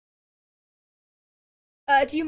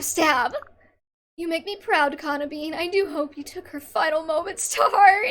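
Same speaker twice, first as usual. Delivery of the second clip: ordinary talk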